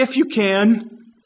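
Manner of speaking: hesitant, drawn-out plea; mild mock wavering prayer; wavering doubt. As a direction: pray it as if unsure. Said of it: "with a wavering mind".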